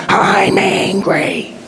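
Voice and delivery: high, drawn-out strained cry, imitated; anguished anger. Boys join Jackson behind the stand-in dad, anguished angry cry. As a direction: imitate boys crying out their anger, stretching each word. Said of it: "they're angry with their dad".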